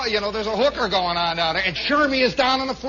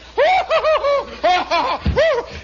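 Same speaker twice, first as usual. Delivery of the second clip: very high, loud whooping laugh; long put-on cackle; mocking hoots; 6 dB louder than usual. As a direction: hoot with jeering laughter. Tormentors' acted hooting laughter.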